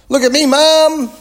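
put-on high, loud child's call to Mum; excited boy calling from the rocket. A narrator's reading. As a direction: shout it across the playground. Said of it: "he called".

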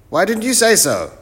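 loud, very high professor voice; muddled professor. Acted. Professor mishears the greeting, answers loud and high. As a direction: boom it out high, quite sure of yourself.

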